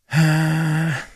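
long high sigh of overwhelm; weary sigh. Real, not acted. Radio host buried in listener texts, sighing. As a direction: let out a sigh at the flood of texts.

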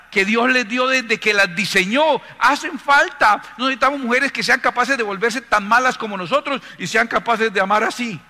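loud, high, forceful preacher's appeal; impassioned preaching. Acted. Preacher urging the crowd that women's gift is needed, impassioned. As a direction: drive each phrase out to the whole congregation.

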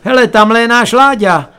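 high, loud call to spot a relative; calling from above. A narrator's reading. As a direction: shout it down from upstairs. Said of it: "ozvalo se najednou zhora".

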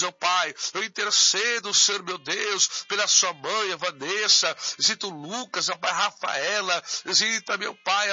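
normal talking voice

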